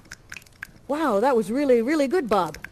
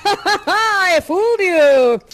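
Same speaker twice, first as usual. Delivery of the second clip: laughing; 5 semitones higher, 5 dB louder, much slower than usual. Acted. Gloating after botched teleport.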